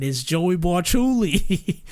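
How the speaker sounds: slow, drawn-out New York name; Joey reveals himself as the mask comes off; New York Joey accent. Shown as a demonstration. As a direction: draw out the name like a New York tough guy. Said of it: "mask comes off".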